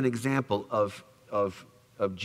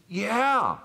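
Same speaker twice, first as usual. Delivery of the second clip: hurt Peter; 9 semitones higher, 5 dB louder than usual. Retold or imitated. Peter answering Jesus again.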